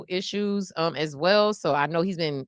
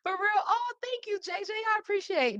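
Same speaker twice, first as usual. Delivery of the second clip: high, softer put-on old lady voice; impression slipping back; 11 semitones higher, 6 dB quieter than usual. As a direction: thank them in a tiny high granny voice. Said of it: "it keep trying to come in me".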